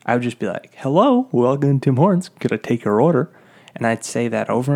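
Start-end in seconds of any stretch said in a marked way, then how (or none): 0.8-3.3 put-on service voice, high rising "hello"; loud, lilting mock customer-service greeting; drive-thru greeter. Worker dreaming of answering the drive-thru, chirpy rote greeting. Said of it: "answering the drive-thru".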